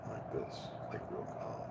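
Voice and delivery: hushed, breathy whisper showing how low they talked; soft demo whisper. Shown as a demonstration. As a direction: drop to a near-silent whisper. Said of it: "we was talking at such a low level".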